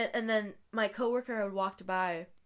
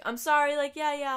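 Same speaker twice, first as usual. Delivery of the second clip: forced politeness; 6 semitones higher, 6 dB louder than usual. Retold or imitated. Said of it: "I'm seeming really deadpan".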